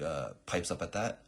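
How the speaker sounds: normal talking voice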